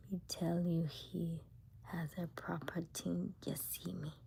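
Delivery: slow, hushed teasing; whispered tease. Real, not acted. Teasing viewers about his claim, slow whisper. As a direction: lean in and whisper it slowly.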